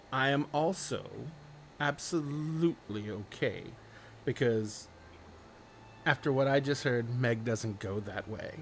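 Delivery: put-on quiet, deliberate young woman with pauses; reluctantly agreeing young woman. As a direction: concede grudgingly, slowing down and dropping away.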